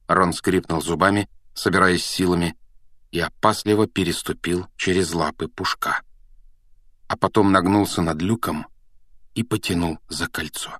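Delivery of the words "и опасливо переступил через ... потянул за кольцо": plain reading voice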